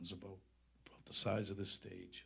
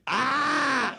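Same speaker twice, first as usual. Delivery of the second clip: retold players' shout; 15 dB louder than usual. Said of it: "Players are going".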